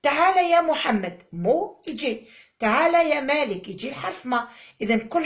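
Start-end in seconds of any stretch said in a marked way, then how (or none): 0.0-1.2 loud, higher classroom call; calling a pupil. Retold or imitated. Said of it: "لما في القسم يكون عندي". 2.6-3.7 louder, higher classroom call; calling a pupil. Retold or imitated. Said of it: "لما في القسم يكون عندي".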